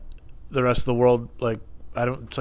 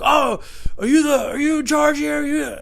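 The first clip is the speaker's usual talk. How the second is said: high, louder imitation of an irate man; crazed customer; 6 dB louder than usual. Irate customer demanding who is in charge, mock crazed. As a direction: mimic a customer going crazy, pitched way up. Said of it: "Going crazy".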